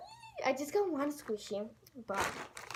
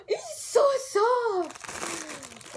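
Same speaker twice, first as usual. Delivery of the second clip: higher, very slow, drawn-out; swooning delight; 8 semitones higher, 4 dB louder, much slower than usual. Real, not acted. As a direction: stretch each word, in raptures. Squishing the softest squishy.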